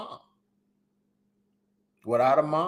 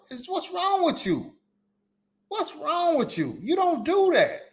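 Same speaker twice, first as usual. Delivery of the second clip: high, sharp scolding mother voice; scolding mom; 13 semitones higher than usual. Retold or imitated. Mom scolds her kids for bothering the lady, high and sharp.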